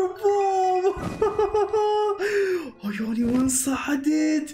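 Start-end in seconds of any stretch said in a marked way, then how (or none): 1.0-2.8 bright burst of giggles; little giggle at the new dragon; high giggle. Real, not acted.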